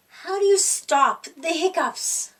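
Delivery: plain reading voice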